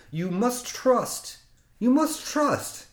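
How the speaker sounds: insistent Russian mantra. Retold or imitated. Mechanic urging the driver to trust him, slow rising Russian mantra. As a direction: press the mantra home slowly. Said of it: "and he's Russian".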